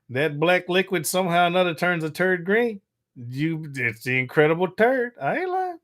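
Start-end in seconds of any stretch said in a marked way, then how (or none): 5.2-5.8 squeaky high protest; high-pitched I ain't lying; insisting. Real, not acted. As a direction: swear it's true in a high voice. Insisting on the story.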